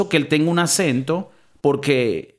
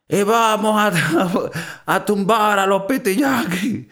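mock Cuban accent, laughing; 6 semitones higher, slower than usual.

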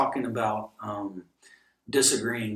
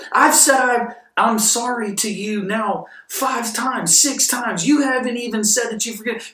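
very high, loud, shouted frustration; exasperated outburst; 11 semitones higher, 10 dB louder than usual. Acted. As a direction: yell that you've apologized enough. Acted exasperated apologizer blowing up.

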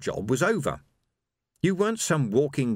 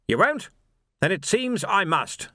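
commanding captain; 9 semitones higher than usual. A narrator's reading. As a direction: command the squad crisply. Captain drawing sword.

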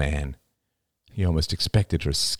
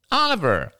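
piercing, high nasal call of a name; high nasal cry; 14 semitones higher, 3 dB louder than usual. A narrator's reading. Jada hailing her cousin at a party, high nasal cry. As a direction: cry out high through the nose. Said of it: "she cried in a high, nasal voice".

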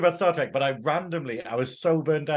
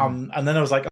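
ordinary talk

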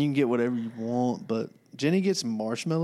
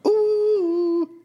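high, loud delighted ooh; excited ooh; 7 dB louder than usual. Real, not acted. Reacting to a tasty order.

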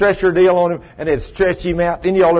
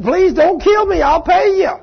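high, loud pleading; desperate begging; 5 dB louder than usual. Acted. Debtor on his knees to the man choking him, begging. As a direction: beg for your life, pitch way up.

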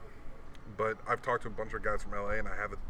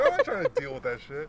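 exasperated; 4 dB louder than usual. Retold or imitated. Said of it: "retelling fear of being cut".

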